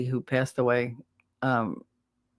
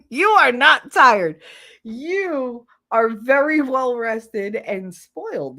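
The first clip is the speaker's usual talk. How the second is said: high, slow, drawn-out telling-off; slow, high-pitched correction of a complainer; emphatic scolding; 8 semitones higher, 4 dB louder, much slower than usual. Acted. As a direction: lay down each word slowly, pitched up, mock indignant.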